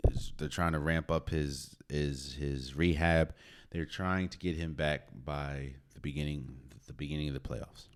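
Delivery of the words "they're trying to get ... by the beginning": ordinary talk, nothing marked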